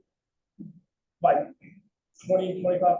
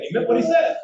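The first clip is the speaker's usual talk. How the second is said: higher and louder, insisting it was serious; emphatic; 11 semitones higher, 6 dB louder than usual. Real, not acted. Telling the audience the warning was real, emphatic. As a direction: land it hard so they believe it.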